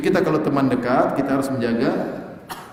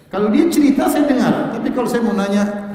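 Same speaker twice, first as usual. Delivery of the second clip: high, loud words broken by laughter; chuckling through the point; laughing; 9 semitones higher, 5 dB louder than usual. Real, not acted. Preacher laughing to the audience about friends' secrets.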